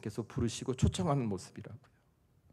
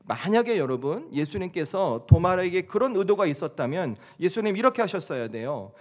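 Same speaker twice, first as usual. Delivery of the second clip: normal talking voice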